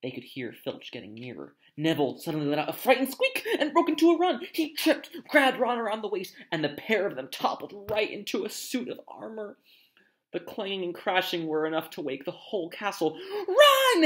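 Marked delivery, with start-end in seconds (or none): 1.8-13.2 higher, urgent chase narration; excited story narration. A narrator's reading. Narrator telling how the children flee Filch, urgent and raised. 13.5-14.0 high, loud shout of alarm; yelled. Acted. Harry to his friends as they flee, a full yell. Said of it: "Harry yelled".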